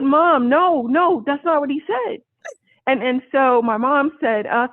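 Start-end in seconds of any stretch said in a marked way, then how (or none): 0.0-2.2 flustered correction. Retold or imitated. Correcting excited mom.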